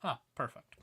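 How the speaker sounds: usual speaking voice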